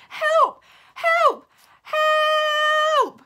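very high, very loud screams for help; desperate screams. Acted. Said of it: "I screamed".

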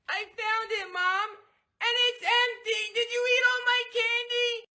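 put-on high, sing-song kid voice in disbelief; upset little boy. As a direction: discover the empty candy bag. Kid discovers empty bag.

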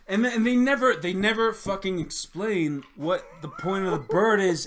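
high, loud, worked-up complaint; indignant outburst. Real, not acted. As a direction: blurt it out, incredulous. Indignant rant.

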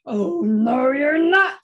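loud, growling troll refusal; loud, menacing storybook troll. Acted. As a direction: boom it out like a mean troll. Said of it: "said the troll".

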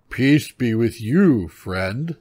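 big, slow, loud bear voice; slow, booming courtesy from a bear. A narrator's reading. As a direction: greet grandly as a storybook bear. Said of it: "said the bear".

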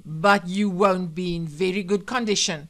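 loud menacing warning; angry threat. Acted. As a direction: threaten him at full voice. Wife threatens husband with gun.

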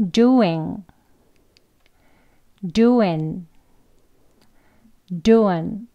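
doing said three ways, strong to weak; three forms of doing. Shown as a demonstration. Teacher modelling each form of doing in turn. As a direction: run doing from full to reduced. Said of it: "각각 세 가지 한 번씩 해볼게요".

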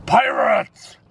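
high alarmed shout of warning. Pretend. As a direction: sound the alarm at full voice. Alarm call about pirates.

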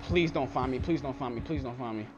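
hushed pleading, barely voiced; whispering while hiding. Real, not acted. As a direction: beg under your breath. Hiding from the killer.

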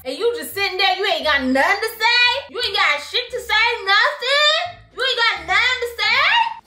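high, rising disbelief, pressing the question; incredulous scolding. Acted. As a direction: scold a friend in high-pitched outrage. Incredulous scolding of passive friend.

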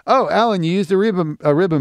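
high, loud sudden discovery; surprised realization. Real, not acted. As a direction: react like it all clicks. Realizing the mic used.

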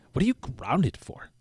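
put-on nosy kid, pitch leaping about; Peter. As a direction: imitate a bratty kid questioning everything. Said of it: "that's how he talks all the time".

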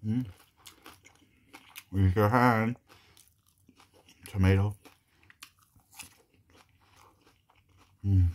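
talking with mouth full; eating while speaking; chewing food. Real, not acted. Seen 2: Face clear; he eats from the bowl and chews throughout the words.

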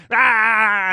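loud, high startled shout; startled yell. Retold or imitated. Drivers hit by a thrown drink, loud startled yell. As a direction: yelp as the drink hits.

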